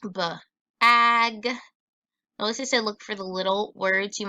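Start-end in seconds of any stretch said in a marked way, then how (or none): 0.0-1.5 B-ag split into its sounds; sounding out. Shown as a demonstration. Teacher sounding out a word for the class, sound by sound. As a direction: break B-ag apart. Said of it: "sounding this out".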